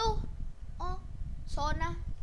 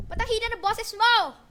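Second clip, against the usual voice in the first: loud complaint; 5 semitones higher, 11 dB louder than usual. Real, not acted. Complaining teammate's mic too quiet.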